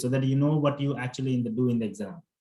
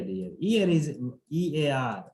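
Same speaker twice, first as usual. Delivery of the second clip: ordinary talk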